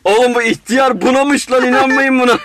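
high mock jeering. Acted mob about Galileo. (yüksek, yavaş alay)

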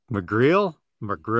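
McGreal said as muh-GREEL, stress on the second syllable, questioning rise; saying McGreal carefully. Shown as a demonstration. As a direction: try out McGreal as muh-GREEL, asking if it's right. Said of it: "Am I saying that last name correctly?".

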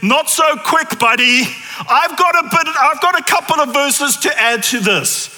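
high, loud cheeky buddy voice; mock cocky interruption. Matthew cutting in loudly on the book of Ruth. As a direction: butt in loud and high, teasing. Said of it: "Matthew says".

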